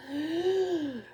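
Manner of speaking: a high, exaggerated gasp of disbelief; mock shocked gasp. Outraged at careless parents.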